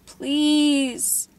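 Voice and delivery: high, loud, broken please; sobbing plea. A narrator's reading. Girl in pain begging again, sobbing. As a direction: cry it out, begging. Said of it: "she sobbed".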